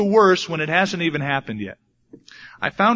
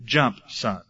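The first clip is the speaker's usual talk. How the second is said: a soft, low hint of a coaxing dad; 5 semitones lower, 4 dB quieter than usual. Father in the pool coaxes his son, low and soft. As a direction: gently coax the boy to jump. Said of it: "my dad says".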